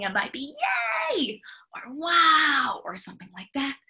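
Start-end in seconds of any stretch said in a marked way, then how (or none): 1.1-1.4 high cheerful yay; excited yay. Shown as a demonstration. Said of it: "if we're excited". 1.9-2.8 loud breathy wow; excited wow. Shown as a demonstration. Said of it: "if we're excited".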